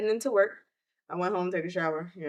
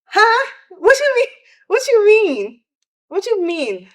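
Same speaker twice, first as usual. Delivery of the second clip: disbelieving shout; 12 semitones higher, 11 dB louder than usual. Retold or imitated. Reacting to uncle's death news.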